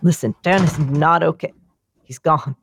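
normal talking voice

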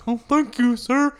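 high, polite manatee voice. Pretend. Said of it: "I like that voice".

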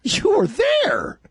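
high, slow, drawn-out accusation; mock accusation. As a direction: pin it on her with mock shock.

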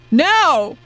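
high loud defiant shout; hollering. Acted. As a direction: yell it back in defiance. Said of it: "Athena hollers".

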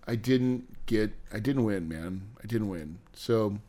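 crushed, fading admission; deflated. Real, not acted. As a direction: let the loss drain the voice out. Admitting he lost the deal.

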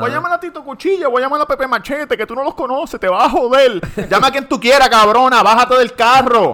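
hammed-up woman screaming accusations; shrieking woman. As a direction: screech it like the furious driver. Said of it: "en ese mismo volumen".

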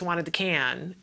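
usual speaking voice

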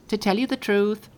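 high, perky Monkey; cheeky young monkey answering; high mischievous monkey voice; Monkey's voice. A narrator's reading.

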